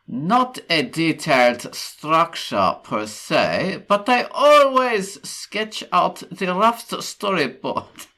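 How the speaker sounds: slow, loud mock German accent; attempted German accent. Host reading a post, hammed-up German accent. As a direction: read it slowly and forcefully in a put-on German accent. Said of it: "try and do a German accent".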